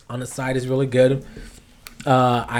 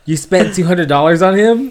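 higher, louder mock accusation, rising at the end; retold outraged disbelief; 6 semitones higher, 7 dB louder than usual. She confronts him over spending on his homie, incredulous. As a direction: imitate her scandalized question.

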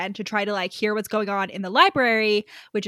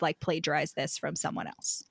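hushed; 7 semitones lower, 10 dB quieter than usual. Retold or imitated. Quoting Lucy's fear, hushed.

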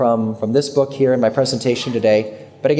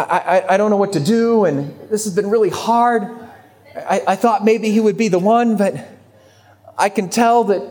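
higher-pitched, halting imitation of crying; mock tearful voice; 5 semitones higher than usual. Friend confiding relationship trouble by phone, mildly tearful imitation. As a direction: imitate a friend faltering through tears, lightly. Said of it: "through her tears, she starts to tell me".

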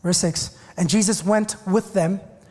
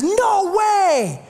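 shocked no way; 9 semitones higher than usual. Retold or imitated. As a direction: blurt it out like a boss who can't lose you. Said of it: "The second one is".

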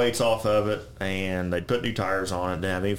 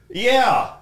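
sarcastic retort; 10 semitones higher, 5 dB louder, slower than usual. Retold or imitated. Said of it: "And I was like".

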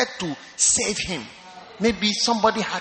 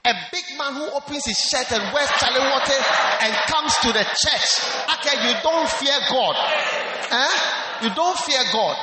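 preaching shout; 3 semitones higher than usual. Acted. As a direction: preach it big and teasing to the congregation. Preacher teasing at full force.

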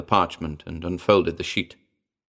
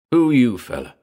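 lightly voiced ape, higher demand in pidgin; 4 semitones higher, 4 dB louder than usual. A narrator's reading. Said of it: "the ape demanded".

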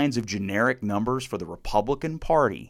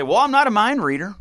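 mocking Biden; 9 semitones higher, 5 dB louder than usual. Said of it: "Joe Biden ... stand in front of a microphone and say".

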